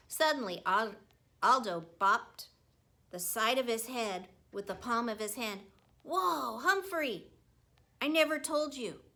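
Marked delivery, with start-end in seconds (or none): 6.0-9.0 sudden realisation. A narrator's reading. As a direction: blurt it as it hits you. Aldo suddenly remembers.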